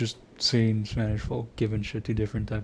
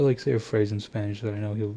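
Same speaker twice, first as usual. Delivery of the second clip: usual speaking voice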